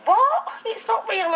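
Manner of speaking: mock disbelief. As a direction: play the stranger's stunned disbelief, big and high. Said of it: "And they go".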